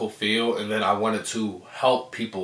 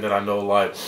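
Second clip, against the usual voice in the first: ordinary delivery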